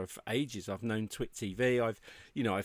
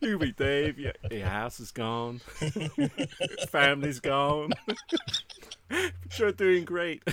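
badly imitated Southern drawl, breaking into laughs; awful Southern accent; 3 semitones higher than usual. Host imitating the guest giving bad news, awful Southern accent. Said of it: "That was an awful accent".

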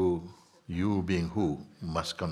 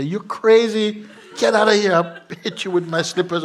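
much higher, louder, laughing mock indignation; laughing outrage; 12 semitones higher, 9 dB louder than usual. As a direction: shoo the stranger off, laughing. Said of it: "You say".

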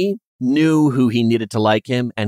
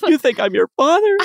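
much higher, overjoyed mock voice; touched emperor; 3 dB louder than usual. Emperor moved by the bow, high mock joy. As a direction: gush it like an overjoyed dad.

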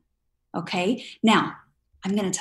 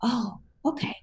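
relieved oh; 4 semitones higher, 4 dB quieter than usual. Retold or imitated. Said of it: "I'm like".

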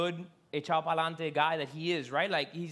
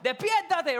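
high, loud frantic wake-up call; panicked plea; 9 semitones higher, 6 dB louder than usual. Retold or imitated. Disciples waking Jesus in the storm, panicked. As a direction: shake him awake in panic.

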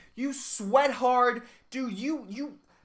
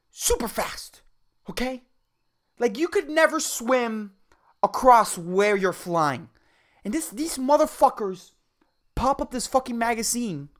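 normal talking voice

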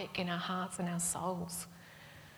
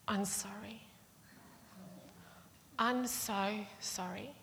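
soft, halting imitation of a sorry kid; small, hushed mock contrition; apologetic child; 2 semitones higher, 8 dB quieter, slower than usual. Four-year-old comes out to the aunt, meekly sorry. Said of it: "out comes this little four-year-old".

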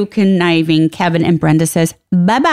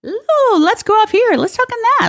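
high giddy scatterbrain; ditzy excited voice; 14 semitones higher than usual. Retold or imitated. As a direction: squeal like an easily distracted friend. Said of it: "and I'm like".

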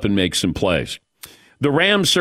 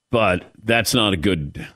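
usual speaking voice

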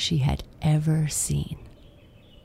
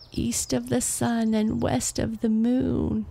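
higher, calling out in despair; cried out; 7 semitones higher than usual. A narrator's reading. Alone with her gold, Astrid cries out, higher. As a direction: call the riddle into the empty land. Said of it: "Astrid cried".

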